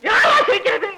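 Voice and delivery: high, tearful comic shout; indignant outburst. Acted. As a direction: cry out in outrage at the very idea. Father outraged at being told laugh.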